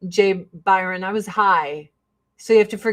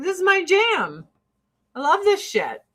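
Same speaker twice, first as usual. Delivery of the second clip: higher-pitched, lightly acted enthusiasm; delighted fan; 8 semitones higher than usual. Fan recalling Family Matters, delighted. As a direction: gush like a happy viewer. Delighted younger self watching favorite show.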